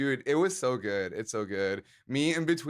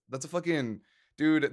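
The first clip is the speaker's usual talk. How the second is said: ordinary delivery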